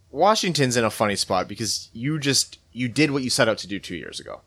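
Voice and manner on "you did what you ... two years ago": ordinary talk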